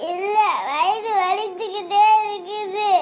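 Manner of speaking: put-on slow, wailing child's whine; crying in pain. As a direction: drag it out tearfully, in pain. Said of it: "ஏன் அழுகுறடா?". (வலியில் இழுத்து அழுதல்)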